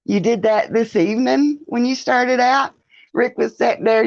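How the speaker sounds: usual speaking voice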